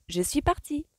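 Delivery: high, lilting singsong rising at the end. A narrator's reading. Felicity announces her exit, singsong. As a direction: chirp it in a little tune. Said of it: "chantonna Felicity".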